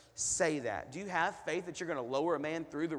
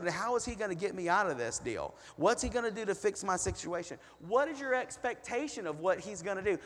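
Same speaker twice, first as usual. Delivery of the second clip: usual speaking voice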